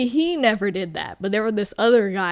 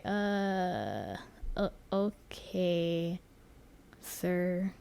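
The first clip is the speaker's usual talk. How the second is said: low, very soft, slow and halting; awkward, unimpressed; 4 semitones lower, 12 dB quieter, much slower than usual. Retold or imitated. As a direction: reenact hesitant, underwhelmed politeness. Retells awkward unimpressed reaction.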